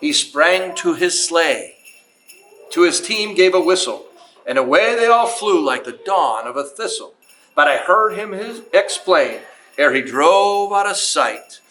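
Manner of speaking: put-on reading a Christmas poem aloud to children, lilting; storyteller voice, unhurried and sing-song; story narration. Museum host reads to the kids in a storyteller voice.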